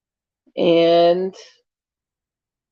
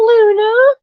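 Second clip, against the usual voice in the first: cooing name; 14 semitones higher, 5 dB louder than usual. Real, not acted. Calling to the cat.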